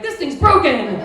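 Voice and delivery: loud annoyed shout; frustrated outburst. Retold or imitated. Comic retelling her annoyance, loud shout. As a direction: yell at the broken toy. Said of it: "I was like".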